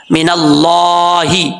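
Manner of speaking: मिनल्लाही slow with a full, heavy lla; मिनल्लाही with a rounded heavy Allah. Shown as a demonstration. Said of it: "अल्लाह का नाम पुर पढ़ा जाएगा".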